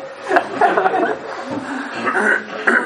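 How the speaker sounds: hearty burst of laughter; laughing. Real, not acted. Workshop participant amused at the answer "depressively", laughing. Group joke about playing it depressively.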